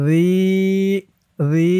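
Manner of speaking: রি held as a long, even "ee"; one-alif stretch. Shown as a demonstration. Teacher shows the one-alif stretch, রি drawn out. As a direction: stretch the vowel of রি on a steady note. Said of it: "এক আলিফ টান".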